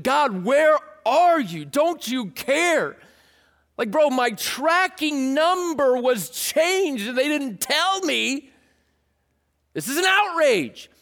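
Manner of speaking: high, loud, slow whiny complaint; petulant believer griping to God, hammed-up whine; mock outrage. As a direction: ham up an entitled gripe.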